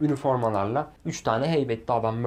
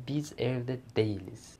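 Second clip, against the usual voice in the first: a hint of mother's voice, soft and slow; lightly voiced mother breaking bad news softly; quiet slow mother; 6 dB quieter, slower than usual. Mother on the phone telling son they're away, soft and slow.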